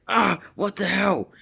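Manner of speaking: high-pitched mock yelp of protest; startled outcry. Villain reacts to a bag thrown in the face, startled outcry. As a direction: act out being caught off guard.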